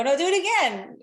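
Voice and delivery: high, loud, bright command to the son; playful insistence. Retold or imitated. Mom insists son smiles.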